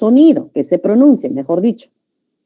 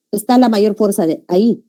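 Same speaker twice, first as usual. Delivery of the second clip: normal talking voice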